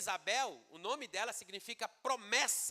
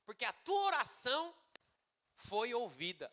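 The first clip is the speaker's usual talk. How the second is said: angel's proclamation; 4 semitones higher than usual. Acted. Angel announcing to Zacarias, solemn reassuring proclamation. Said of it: "o anjo falou assim".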